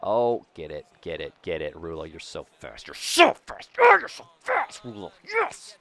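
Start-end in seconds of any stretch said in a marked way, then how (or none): 2.9-5.8 squealing high and loud at his runner; excited cheering. Real, not acted. Streamer squealing at his fast runner, excited. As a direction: shriek him home.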